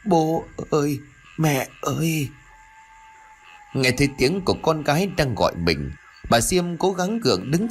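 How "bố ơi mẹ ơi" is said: weak whisper. A narrator's reading. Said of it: "nó thều thào nói yếu ớt".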